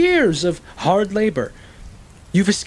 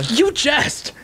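indignant outburst; 10 semitones higher than usual. Acted. Accused of jesting, indignant.

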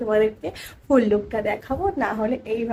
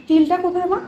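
high, raised call across the house; calling out to mom; 8 semitones higher, 3 dB louder than usual. Real, not acted. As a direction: call out to mom in the next room. Calls to mother off camera.

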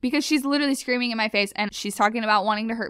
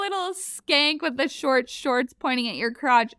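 mock accuser; 7 semitones higher, 3 dB louder, slower than usual. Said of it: "She's like".

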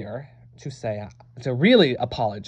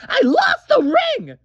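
much higher, loud crying shout; shouted confession; 8 dB louder than usual. Acted. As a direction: blurt it out in a wail. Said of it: "Still can't hear you".

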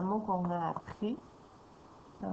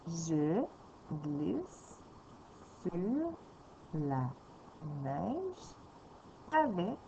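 each word apart with long pauses; slow reading for a young reader; 5 dB quieter, much slower than usual. Shown as a demonstration. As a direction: read slowly, one word at a time. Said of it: "Je vais aller un petit peu moins vite".

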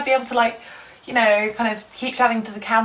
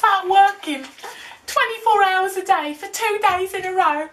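high excited rant; 8 semitones higher than usual. Real, not acted. Sudden high loud complaint.

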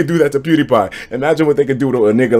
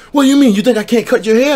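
offended barber; 11 semitones higher than usual. Retold or imitated. Barber snapping back at a picky customer, high and offended. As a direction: play the barber taking offense.

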